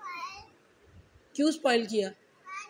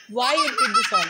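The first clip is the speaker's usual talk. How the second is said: louder, slow "Why you did this all" through laughter; laughing scold; 2 semitones higher, 6 dB louder, much slower than usual. Real, not acted. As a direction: try to scold but keep laughing. Mother scolding while laughing.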